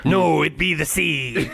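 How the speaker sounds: slow, hammed-up pirate punchline; mock pirate with a laugh. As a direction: draw it out like a joking pirate. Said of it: "do you know what a pirate's favorite letter is?".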